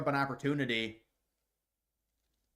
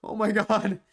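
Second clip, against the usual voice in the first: higher, louder hammed-up fake sob; mock tearful gasp; 6 semitones higher, 6 dB louder, slower than usual. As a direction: overplay a fake crying gasp. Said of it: "fight the tears up".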